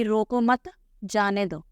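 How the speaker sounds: रोको मत together, short break, then जाने दो; pause after मत. Shown as a demonstration. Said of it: "कि उसे रोको मत".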